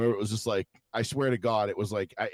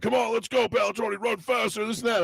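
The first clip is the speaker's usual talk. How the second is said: high mock coach yelling; 12 semitones higher than usual. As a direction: imitate a coach pushing you to run.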